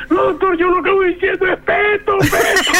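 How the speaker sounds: high, laughing vendor voice insisting on "Petro"; vendor's laughing excuse. Retold or imitated. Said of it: "Y me dice el tipo". (riéndose, imitando al vendedor)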